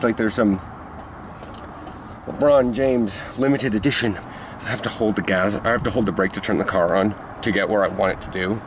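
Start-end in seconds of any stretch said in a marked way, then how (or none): 4.6-8.6 talking around a mouthguard; words muffled by a mouthguard; wearing a mouthguard. Real, not acted. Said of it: "I still have my mouth guard in".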